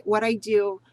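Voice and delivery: ordinary talk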